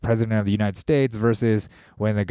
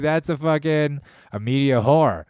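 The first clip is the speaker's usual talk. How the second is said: usual speaking voice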